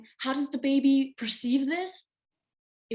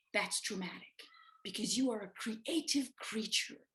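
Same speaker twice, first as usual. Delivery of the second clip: whisper; 7 dB quieter than usual. Real, not acted. Speaker confiding to listeners in a whisper. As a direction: drop into a whisper.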